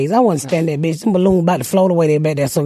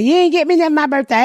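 higher, fast complaining whine; mock whine; 8 semitones higher, faster than usual. Quisha complaining about her birthday, higher and fast. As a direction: whine like you were left out. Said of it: "So she's like".

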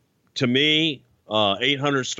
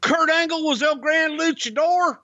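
high announcer voice. Acted. Mock shock at masked reveal.

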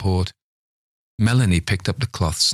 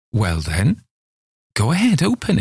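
put-on high eager old man; 5 semitones higher than usual. Old man urging nurse.

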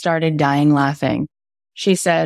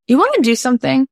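higher, louder, bright eager ask; eager invitation; 9 semitones higher, 4 dB louder, faster than usual. Retold or imitated. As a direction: pitch it up like a keen invite. Neighbor asks Jason Segel eagerly.

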